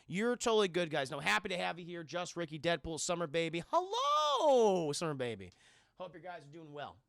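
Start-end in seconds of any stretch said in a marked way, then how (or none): 3.7-5.5 playful greeting. Pretend. Streamer greets a viewer in chat, playfully exaggerated. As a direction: sing out a hammed-up hello.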